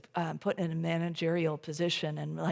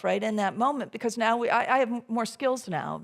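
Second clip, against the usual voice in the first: ordinary talk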